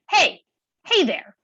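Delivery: high, loud albatross call; high-pitched, annoying cry from overhead; screechy albatross. A narrator's reading. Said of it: "voice is high-pitched and screechy".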